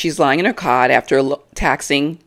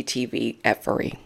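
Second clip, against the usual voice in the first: whisper; 5 semitones lower, 9 dB quieter than usual. Real, not acted. Snide aside.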